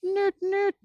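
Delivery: very high squeaky penguin noot; Pingu impression. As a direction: honk it high like the cartoon penguin. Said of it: "That was my Pingu impression".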